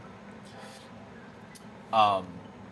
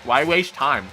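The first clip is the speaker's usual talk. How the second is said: ordinary delivery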